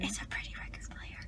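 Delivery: whispery, very soft aside; low, barely voiced murmur; hushed echo. Real, not acted. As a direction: answer your friend under your breath. Echoes friend's hushed aside.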